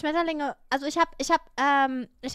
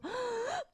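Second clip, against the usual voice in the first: sharp high gasp; speechless gasp; 10 semitones higher, 8 dB quieter than usual. Shown as a demonstration. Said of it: "Es ist nur so". (hohes, sprachloses Luftschnappen)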